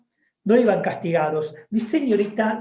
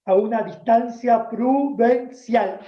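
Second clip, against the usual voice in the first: stern teacher; 3 semitones higher, slower than usual. Retold or imitated. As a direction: order the pupil to stand straight. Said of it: "la señorita decía". (maestra severa dando órdenes lentas)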